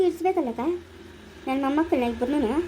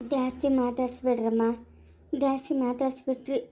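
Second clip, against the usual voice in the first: softer, lower, slowed caution; hushed warning; 5 semitones lower, 5 dB quieter than usual. Acted. Warning the women not to tire the patient, hushed. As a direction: say it under your breath.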